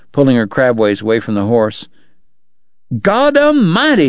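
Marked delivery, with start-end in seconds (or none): 3.0-4.1 high, drawn-out cry of alarm. A narrator's reading. Doc to Kate once out of the horse's range, crying out. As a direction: cry out slowly, pitched way up. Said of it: "he cried when they were out of range".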